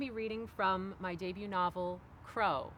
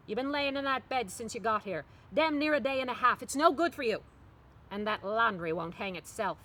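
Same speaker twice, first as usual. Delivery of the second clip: scolding mother; 3 semitones higher, 6 dB louder than usual. Acted. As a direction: scold her like Mama at the bedside. Mama scolding daughter in bed.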